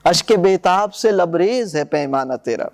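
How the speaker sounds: reading Iqbal's poem aloud to the class; poem recitation. Acted. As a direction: recite the couplets with weight. Recites verse.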